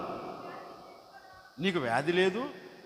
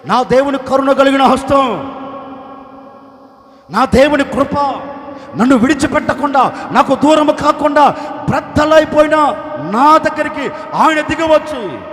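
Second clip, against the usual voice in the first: loud, higher preaching; fervent proclamation; 4 semitones higher, 10 dB louder than usual. Acted. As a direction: proclaim with full force. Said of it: "Nehemiah అంటాడు".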